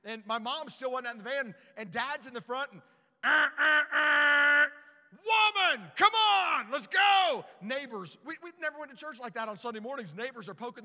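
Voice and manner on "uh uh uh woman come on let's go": high, loud, hurried dad shouting; impatient father calling from the van; impatient dad yelling. Retold or imitated. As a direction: bellow like a father late for church.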